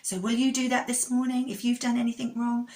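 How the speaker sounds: put-on kids show host. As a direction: cheer the children on. Kids show host, upbeat address.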